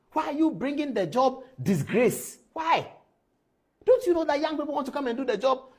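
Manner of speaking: high-pitched, exasperated rebuke; indignant scolding. Real, not acted. Host berating a fellow journalist for disgracing the job, indignant scolding. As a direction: hammer out each question, voice pitched high.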